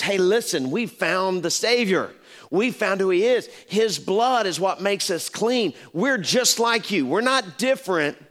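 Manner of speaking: high, impassioned call-out; urgent proclamation. Acted. Leper tells other lepers of the Savior, high and urgent. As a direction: proclaim it with lifted pitch and force.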